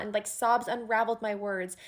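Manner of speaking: normal talking voice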